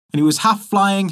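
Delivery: ordinary delivery, nothing marked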